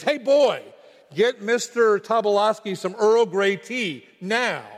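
sudden loud, high-pitched command; staffer's yell. Retold or imitated. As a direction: yell a curt order at an underling. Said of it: "The staffer yelled".

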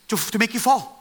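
normal talking voice

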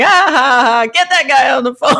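loud mock laugh and shout; loud laughing imitation. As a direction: mimic a guy laughing, shouting for the call. Imitating prospect laughing loudly.

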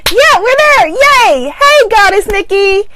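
high, loud, delighted greeting; real high-pitched burst of excitement; excited cheer. Real, not acted. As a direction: cheer loudly and high as the connection returns. Relieved live stream is back.

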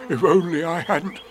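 a touch of mid-tumble dismay; falling bear's regret. Acted. Bear bouncing down the branches, mild dismay. Pooh speaks while falling.

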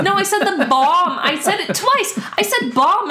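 high, exasperated insistence; exasperated protest. Real, not acted. Defending her pronunciation.

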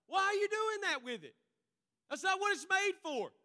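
scolding friend. Acted.

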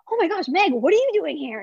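excited surprise. Retold or imitated. Bumping into an old friend.

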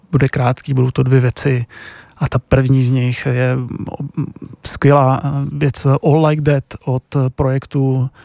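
normal talking voice

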